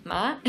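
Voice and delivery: ma rising like a question; ma asked upward, light. Shown as a demonstration. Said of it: "almost like a question".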